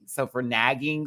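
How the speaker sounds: ordinary delivery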